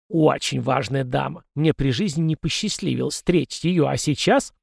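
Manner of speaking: ordinary talk, nothing marked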